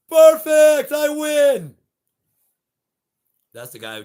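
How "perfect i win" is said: high and very loud, triumphant; gloating scream. Pretend. The guillotine man winning, screaming in triumph. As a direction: scream your victory.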